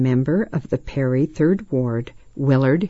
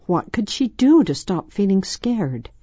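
ordinary delivery

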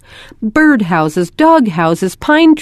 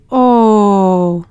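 a small let-down oh; child's disappointed oh at the dark lights. A narrator's reading. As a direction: sigh out the oh, deflated. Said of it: "Rose said disappointedly".

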